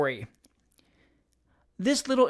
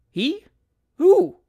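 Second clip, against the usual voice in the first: high, startled questioning; crowd's cry; 13 semitones higher, 8 dB louder than usual. A narrator's reading. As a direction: call out in astonished demand. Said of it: "cried everybody".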